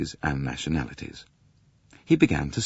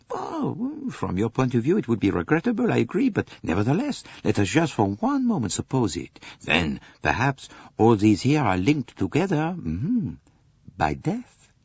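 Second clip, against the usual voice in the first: lightly voiced detective, a little higher; a hint of a sly foreign detective; musing Poirot; 7 semitones higher than usual. A narrator's reading. Poirot imagines an accident.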